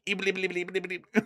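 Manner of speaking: high stuttered bleeps; Porky Pig stutter. Pretend. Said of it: "like, Porky Pig".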